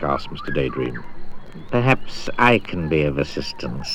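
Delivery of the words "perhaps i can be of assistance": a lightly lower, slick crocodile; oily voice. Acted. Crocodile offering help at the river, oily and low. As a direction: sound smooth and scheming. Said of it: "said a particularly oily voice".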